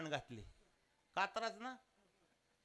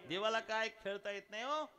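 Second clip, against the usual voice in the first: usual speaking voice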